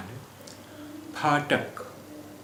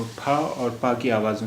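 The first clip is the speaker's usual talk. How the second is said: normal talking voice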